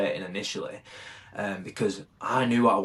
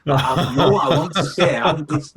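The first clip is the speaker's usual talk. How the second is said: laughing frustration; 4 semitones higher, 6 dB louder than usual. Real, not acted.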